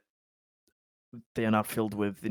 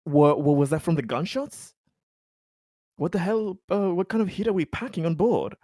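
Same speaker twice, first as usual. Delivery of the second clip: high-pitched, exasperated questioning; agitated demand; 7 semitones higher, 5 dB louder than usual. Acted. Officer questioning crew about the damage, agitated. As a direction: demand answers, stammering, pitch raised.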